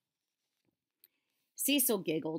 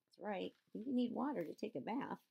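usual speaking voice

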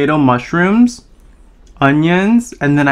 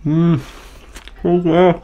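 biting into food; eating while talking; mouth full; 5 semitones higher, slower than usual. Real, not acted. Seen 1: Pizza pressed in mouth, biting through whole labelled stretch.

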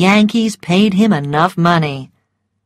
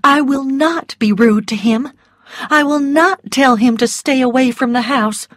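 firm but gentle resolve; a hint of brave Melanie; 7 semitones higher, 3 dB louder than usual. A narrator's reading. Melanie voiced in story.

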